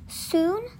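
ordinary delivery

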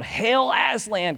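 high, piping little knight; a tiny brave mouse hailing his lion king; shrill mouse voice. A narrator's reading. The mouse Reepicheep greets Aslan in a high, piping voice. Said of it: "came his shrill voice".

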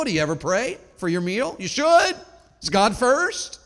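ordinary talk

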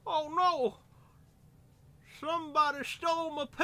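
high, loud hammed-up wail; mock wail. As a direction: cry out over the stolen dog. Voicing the movie clown.

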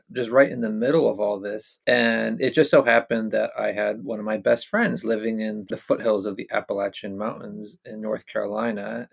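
usual speaking voice

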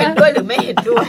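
high shout through laughter; very loud, high, playful challenge; mock vote demand. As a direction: yell the question, laughing. Joking shouted call to vote.

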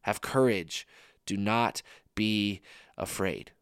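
soft and slow, drawn-out do not be afraid; slow, hushed, reassuring Jesus; slow tender reassurance. Shown as a demonstration. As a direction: comfort a frightened friend. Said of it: "repeats line slowly and softly".

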